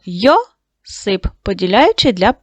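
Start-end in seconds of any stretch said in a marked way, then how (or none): 0.0-1.3 Йосип stretched slowly into Йо-сип; syllable by syllable. Shown as a demonstration. Teacher dividing the word into syllables for pupils, slow and drawn out. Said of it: "поділяючи на склади".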